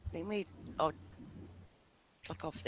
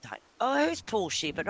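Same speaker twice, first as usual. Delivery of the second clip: ordinary delivery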